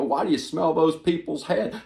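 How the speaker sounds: high, mocking heckler taunting him. Heckler mocking Joe, high mocking taunt. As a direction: sneer the question like a heckler. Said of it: "I hear you call me Creepy Joe".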